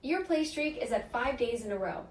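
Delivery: usual speaking voice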